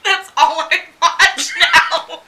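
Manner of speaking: laughing while confessing. Real, not acted. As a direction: giggle through the words. Embarrassed laughing admission.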